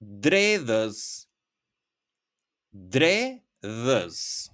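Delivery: dredhës modelled again; review demo. Shown as a demonstration. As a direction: repeat dredhës carefully for review. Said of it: "So once again".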